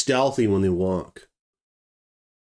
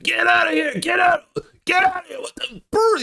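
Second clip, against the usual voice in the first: imitating a panicked high-pitched yell; worked-up yelling. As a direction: yell it very high, frantic at the intruder. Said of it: "he was worked up".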